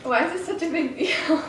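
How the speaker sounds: high, upset outburst; exasperated. Real, not acted. A woman upset over another negative test, pitched high. Frustrated clip of failed test.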